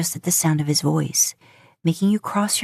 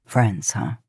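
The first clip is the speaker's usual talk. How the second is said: wry; 8 semitones lower than usual. A narrator's reading. Man hearing he's friend-zoned, wry and lower. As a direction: lower male voice, dryly amused. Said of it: "Dean says wryly".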